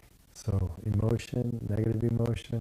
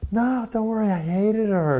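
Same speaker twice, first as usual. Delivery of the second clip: high, loud mock protest; emphatic dismissal; 12 semitones higher, 6 dB louder than usual. Sponsor waving off the teachers' sympathy, emphatic dismissal. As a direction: blurt it out as the blunt sponsor, pitched way up. Sponsor bluntly reassuring the mourners.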